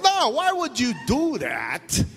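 high, loud, slow mock mom; mom's reply. Mom refusing to let kids out, high slow mock voice. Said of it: "She goes".

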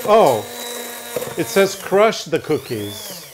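ordinary talk

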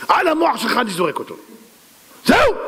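high, outraged royal threat; acted angry decree. King threatening to throw the rock, enraged. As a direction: pronounce it high, ending in a shout.

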